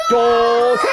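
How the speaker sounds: shouting the name out; call for daughter. Acted. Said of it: "Shout for the daughter".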